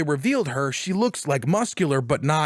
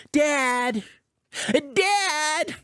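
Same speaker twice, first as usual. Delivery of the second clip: put-on child calling dad; 10 semitones higher than usual.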